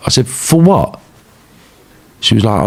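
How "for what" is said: high, disbelieving question with falling end; indignant, high-pitched query; incredulous retort. Retold or imitated. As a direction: demand an explanation in disbelief. Repeats baffled reply on hearing names.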